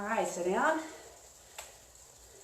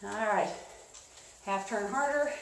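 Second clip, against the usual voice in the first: usual speaking voice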